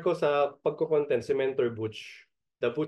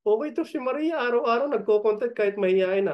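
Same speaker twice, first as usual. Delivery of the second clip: normal talking voice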